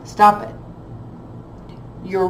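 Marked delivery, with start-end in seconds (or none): 0.0-0.6 firm stop it, pushed out loud; loud scolding command; louder stop it. Real, not acted. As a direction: raise your voice and cut them off. Reader scolding the viewer.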